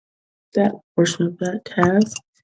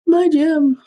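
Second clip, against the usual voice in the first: high-pitched little wail; playful dismay; 11 semitones higher, 3 dB louder than usual. Real, not acted. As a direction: wail over a lost trinket. Lost a gem while crafting.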